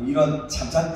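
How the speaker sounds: ordinary talk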